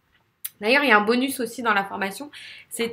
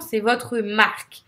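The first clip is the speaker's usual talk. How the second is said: normal talking voice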